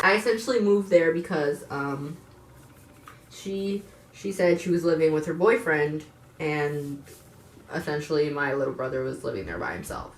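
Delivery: ordinary delivery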